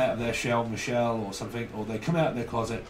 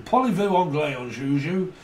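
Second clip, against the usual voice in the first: higher, loud mock-French accent; 4 semitones higher, 5 dB louder than usual. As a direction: put on a comic French accent. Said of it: "like, uh, the French accent".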